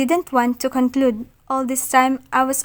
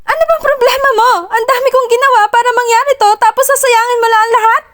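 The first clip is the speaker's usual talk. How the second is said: high, fast, furious yelling; angry shout; 11 semitones higher, 6 dB louder, faster than usual. Acted. Achilles yelling at her for ruining everything, angry shout. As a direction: blast it at her, much higher. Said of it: "I cut him off with a louder shout".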